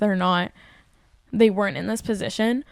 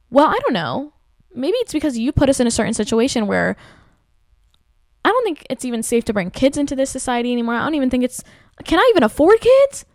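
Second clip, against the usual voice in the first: heated retort; 4 semitones higher, 5 dB louder than usual. Retold or imitated. Retorting to older generation.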